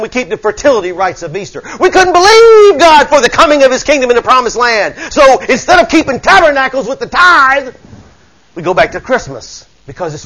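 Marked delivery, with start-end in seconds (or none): 1.8-7.7 rising preacher's cry; fervent preaching. Acted. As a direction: preach it with fire to the church. Preaching at full force.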